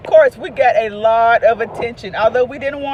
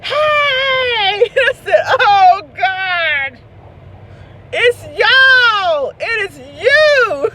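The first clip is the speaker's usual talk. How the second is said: high, loud, laughing cry; laughing realization; 10 semitones higher, 6 dB louder, much slower than usual. Retold or imitated. Mother realizing her family caused the yelling, laughing loudly. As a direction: laugh it out in disbelief.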